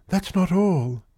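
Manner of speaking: put-on distressed girl confiding; troubled girl. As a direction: admit there's worse. Girl confesses more.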